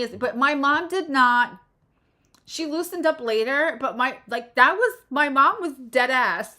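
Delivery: ordinary talk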